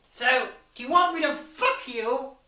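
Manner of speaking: blunt theatrical proposition; Diamanda's voice. Acted. Diamanda asking the chick, in a brash villain voice.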